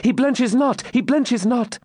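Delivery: high-pitched, fervent maiden; excited denial. Acted. Maiden at window defends the champion, excited. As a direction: insist it high and breathless with excitement.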